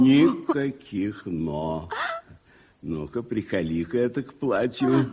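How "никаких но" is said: slow, drawn-out refusal cutting her off; никаких dragged out slowly, firmly; firm no buts. Acted. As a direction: lean hard on никаких, slowly, then drop. Uncle cuts off niece's protest.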